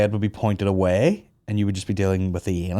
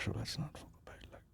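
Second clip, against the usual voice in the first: whispered aside; 24 dB quieter, faster than usual. Real, not acted. Host whispers to co-host about the Range Rover. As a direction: drop to a breathy whisper.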